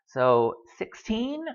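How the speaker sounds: normal talking voice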